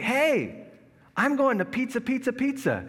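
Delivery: cheery sing-song. Retold or imitated. Passing friend happily announcing his pizza trip, high sing-song. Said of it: "and he's like".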